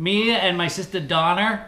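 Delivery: put-on Boston, R's swapped about; Boston accent. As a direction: chat like a Boston local. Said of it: "Drop the Oz".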